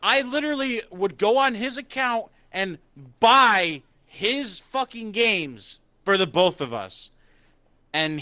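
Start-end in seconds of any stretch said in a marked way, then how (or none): 0.0-7.1 high, exasperated rant; indignant retort. Retold or imitated. Recounting paying for a friend's games, indignant high rant. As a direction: pile on the outrage, pitch way up.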